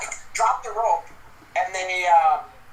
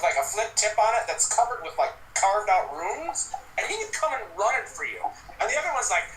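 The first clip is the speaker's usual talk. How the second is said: ordinary talk, nothing marked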